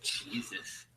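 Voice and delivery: breathy whispered aside. Real, not acted. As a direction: whisper it under your breath. Muttered reaction.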